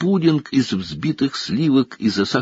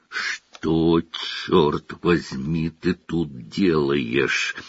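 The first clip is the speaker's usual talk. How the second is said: slow rasp through gritted teeth; hoarse, slow, teeth clenched; 5 semitones lower, 4 dB quieter, much slower than usual. A narrator's reading. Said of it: "прохрипел дядя Вернон сквозь стиснутые зубы".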